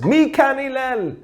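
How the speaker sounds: imitated screaming. Man hollering for Hillel outside his house, mock screaming. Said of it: "starts screaming at the top of his lungs".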